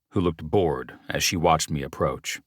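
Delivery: usual speaking voice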